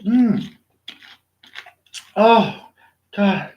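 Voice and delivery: ordinary talk, nothing marked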